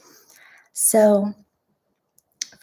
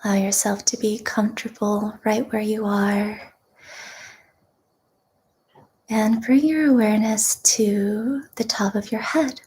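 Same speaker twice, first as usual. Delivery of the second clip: guided meditation; 3 semitones lower, 5 dB quieter than usual. Real, not acted. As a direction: guide them gently into deep breathing, unhurried.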